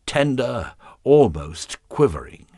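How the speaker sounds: ordinary delivery, nothing marked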